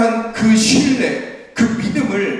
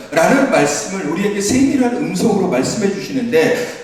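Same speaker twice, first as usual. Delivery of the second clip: ordinary talk, nothing marked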